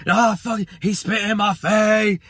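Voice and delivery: imitating a delayed furious outburst; outraged yell. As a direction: bellow it like you've just noticed the spit. Voice finally reacts outraged.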